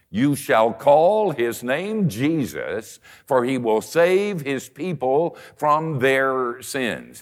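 slow, solemn recitation; scripture reading. Acted. Preacher reciting the Christmas verse to the congregation, slow and solemn. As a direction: read the verse slowly and solemnly.

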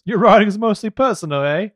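loud, high comic Cockney; Cockney accent. Acted. Teasing co-host about lost notes, Cockney accent. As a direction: tease in a put-on Cockney. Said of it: "started doing a Cockney accent".

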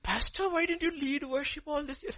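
high-pitched, lightly mimicked questioner; high imitated voice of amazement; imitated surprised admirer. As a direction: quote an amazed churchgoer, pitched well up.

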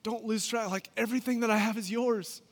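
father's voice. Acted. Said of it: "the father reminds him".

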